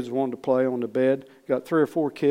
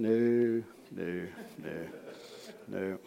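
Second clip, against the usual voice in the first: low, soft repeated no; fading admission; 6 semitones lower, 11 dB quieter, much slower than usual. Retold or imitated. Preacher admits he has missed God before, quietly fading. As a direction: let each no sink away quieter. Answers own question, fading regretfully.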